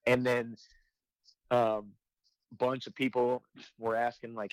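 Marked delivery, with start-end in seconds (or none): none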